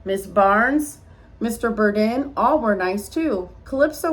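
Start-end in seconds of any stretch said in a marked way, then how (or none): none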